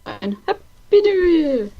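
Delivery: drawn-out high New Year cheer; slow, celebratory call; festive greeting. Real, not acted.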